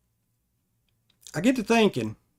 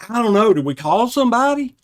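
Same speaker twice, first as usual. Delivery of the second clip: much higher and louder, flustered; retold panic; 8 semitones higher, 8 dB louder, faster than usual. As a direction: scramble for what to do next. High from fumes, panicking.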